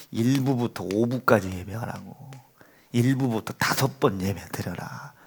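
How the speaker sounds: stern whisper of a father's command; hushed scolding. Retold or imitated. Father quietly reminding son of his order.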